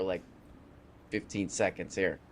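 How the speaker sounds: usual speaking voice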